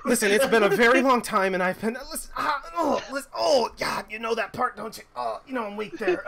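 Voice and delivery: high, loud rattled pleading; father fending off his angry daughter, high and rattled; flustered father. Acted. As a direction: beg her to stop, high and loud. Father defending against daughter.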